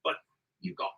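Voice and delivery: very soft, near-whispered impression; halting, hushed comic delivery; Richard Ayoade. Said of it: "you could do the thing where he".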